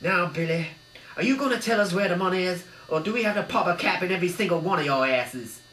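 loud, fast thug threat; glowering gangster. A narrator's reading. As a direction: lean in on Billy. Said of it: "glowered Arnie".

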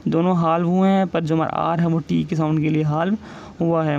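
ordinary talk

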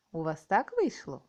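ordinary talk

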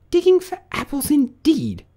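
very high, indignant rabbit; angry rabbit. A narrator's reading. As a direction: throw it back in high-pitched outrage. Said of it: "said the rabbit angrily".

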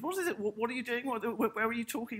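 a light take on a confused mum asking where you're talking; mother on the phone. Retold or imitated. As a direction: play the puzzled mother ringing up. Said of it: "my mother also rang me up and said".

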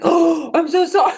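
high, loud horrified apology, re-enacted; gasping apology. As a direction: cry out a hammed-up, laughing-edge sorry. Retells horrified apology to painted son.

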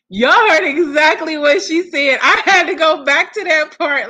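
hyped reaction to the clip; loud excited. Real, not acted. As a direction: tell everyone, thrilled.

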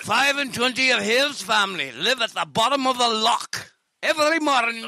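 hammed-up Scottish hard-luck tale; Scottish accent. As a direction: recount childhood misery in a broad Scottish accent. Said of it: "it sucks to be Scottish".

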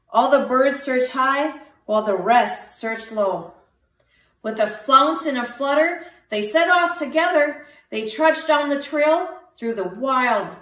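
usual speaking voice

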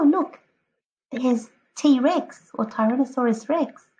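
ordinary delivery

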